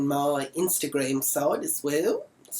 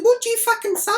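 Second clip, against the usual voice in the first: shrieked, much higher and louder; furious high shout; 7 dB louder, faster than usual. Retold or imitated. Confronting Janelle who mocked her, furious high shout. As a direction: blow up at her with a screech.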